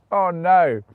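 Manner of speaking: loud, high cry after laughing; amused dismay. Real, not acted. Streamer reacts to a bad pun, laughing dismay. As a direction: wail it loudly, still tickled.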